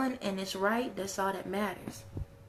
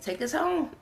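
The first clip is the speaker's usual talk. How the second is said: laughing; 5 semitones higher than usual. Real, not acted. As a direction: blurt it out laughing. Laughing joke line.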